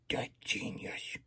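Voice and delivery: slow, hushed whisper of amazement; awed whisper. Real, not acted. Puppet amazed at the friendship pie, whispering. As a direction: breathe it out like a secret discovery.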